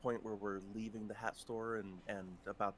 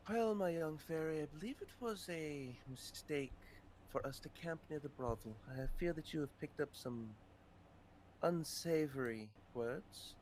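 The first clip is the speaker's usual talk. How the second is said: softly reproachful, a hint of formal fussiness; lightly voiced in-character elder; 3 semitones higher, 4 dB quieter than usual. Character chiding a young fairy about the brothel, lightly formal and soft. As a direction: deliver a mild, measured reproach in character.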